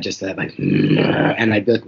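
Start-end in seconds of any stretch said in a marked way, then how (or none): 0.6-1.3 deep frustrated growl from the chest; bear growl. Retold or imitated. Dad pushed too far by his son, deep bear growl. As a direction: let out a low bear growl. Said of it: "that bear growl come out of my dad".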